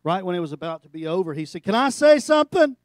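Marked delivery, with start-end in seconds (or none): 1.6-2.8 loud, high, pleading voice; loud plea. Retold or imitated. Weeping pastor asks graduation crowd, high and loud.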